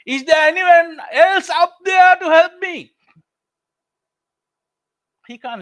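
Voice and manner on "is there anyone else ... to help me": high, loud desperate yell; scream for help. A narrator's reading. Jack, still hanging, screams for another rescuer. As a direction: shout up for someone else. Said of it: "starts screaming again".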